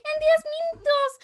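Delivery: high, loud panicked repeat; imitating a startled customer; alarmed repeat. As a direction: echo it back in alarm.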